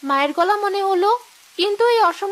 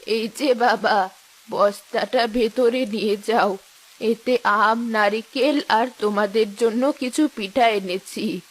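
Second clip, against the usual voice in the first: put-on old mother; 10 semitones lower than usual. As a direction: call out like an elderly mother.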